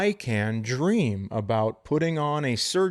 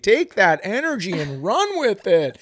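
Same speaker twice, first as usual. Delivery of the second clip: high, raised-voice triumph; gleeful exulting; 7 semitones higher, 3 dB louder than usual. Real, not acted. Host relishing a rival's miss, gleeful exulting. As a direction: crow about the win.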